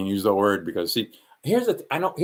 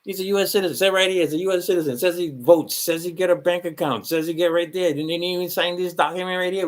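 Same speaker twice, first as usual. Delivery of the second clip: mock official; 6 semitones higher than usual. Officials mocked in retold scene.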